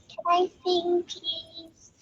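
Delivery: high, soft begging voice; imitating a girl meekly pleading; pleading random girl. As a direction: ask timidly, high and small, trailing away on please. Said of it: "this random girl who's like".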